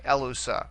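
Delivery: "Elusa" accented on the opening E; stress on first syllable. Shown as a demonstration. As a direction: stress the E of "Elusa" and let the rest fall. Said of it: "accent there on the first syllable".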